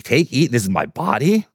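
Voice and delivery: puzzled echo. Retold or imitated. Disciples echoing Jesus's unexpected words, acted puzzlement. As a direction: repeat it as a baffled question. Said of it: "what does he mean".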